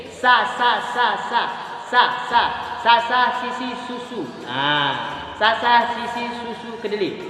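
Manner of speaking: "sa" said plainly on the tongue tip; light repeated "sa, si, su"; plain sin. Shown as a demonstration. Teacher drilling sin for students. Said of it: "Nyebutnya biasa".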